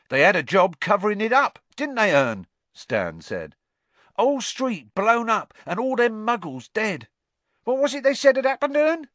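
a high, loud, chatty voice; cheeky Cockney conductor. A narrator's reading. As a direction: gossip like a bus conductor. Stan asks Ern.

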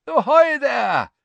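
high, loud, slow happy hail; called happily. Acted. Bagman hailing the Weasleys across the campsite, happy shout. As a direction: call out joyfully across the camp. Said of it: "Bagman called happily".

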